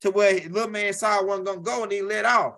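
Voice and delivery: ordinary talk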